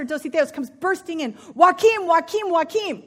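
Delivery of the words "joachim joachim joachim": loud urgent call, higher. Acted. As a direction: shout his name across the room. Master bursts in calling.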